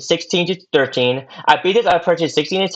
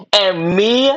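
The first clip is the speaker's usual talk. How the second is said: normal talking voice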